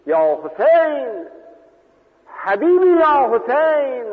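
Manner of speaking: slow, high, loud wailing call; stretched mournful shout; anguished cry. Retold or imitated. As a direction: cry out the name like a grieving friend. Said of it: "دو بار یا سه بار فریاد کشید".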